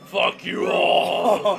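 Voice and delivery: slow, loud, raging roar; berserk rage. Pretend. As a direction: bellow it in a berserk fury.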